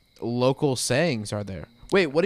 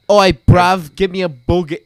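hammed-up British accent, a bit louder; a lightly mocking British voice; 3 semitones higher, 9 dB louder than usual. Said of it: "that's so British".

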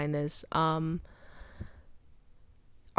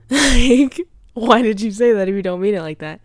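loud, higher, rushed retold challenge; indignant challenge; 4 semitones higher, 9 dB louder than usual. Sister challenging mom over her words, indignant retelling. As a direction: confront her with raised voice, acting out the quote.

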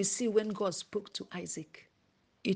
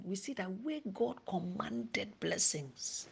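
slow, much softer hushed words; slow whisper; 9 dB quieter, slower than usual. Real, not acted. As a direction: draw it out in a whisper.

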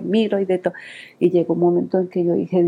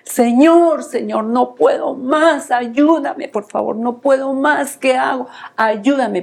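desperate shouted prayer; 7 semitones higher, slower than usual. Retold or imitated. Mother at her wit's end shouting her prayer to God. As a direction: cry out to the Lord at the end of your rope. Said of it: "y yo gri-, grité, le dije".

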